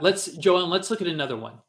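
usual speaking voice

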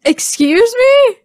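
high, stretched incredulous questions; shocked disbelief. Real, not acted. Friend shocked by a crude comparison, incredulous high query. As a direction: question it slowly, pitch shooting up. Shocked at co-host's orgasm remark.

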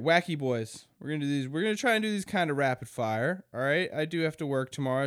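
usual speaking voice

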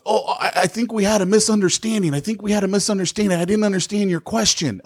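high, fast, fumbling mock salesman; flustered salesman. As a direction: play the caught liar scrambling for an excuse. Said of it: "and he goes".

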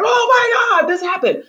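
thrilled shock at the episode; excited disbelief. Retold or imitated. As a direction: gasp it out like you just saw it. Recalling shock at the scene.

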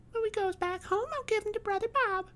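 put-on very high, small child's voice; innocent, high little-girl chatter. A narrator's reading. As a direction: pipe up eagerly as a five-year-old.

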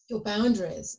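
ordinary talk, nothing marked

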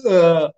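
loud mock "ugh" of disgust; acted-out revolted groan. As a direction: groan as if licking something slimy. Acts out licking the frog.

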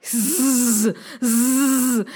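long buzzing snake hiss. A narrator's reading. Narrator imitates the sound at the river, hissing. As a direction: make a sustained serpent hiss. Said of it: "there was a hissing sound".

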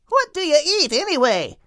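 two birds swooping down to ask the whale; birds' curious voice. Acted. Crinan and Bethune ask the whale.

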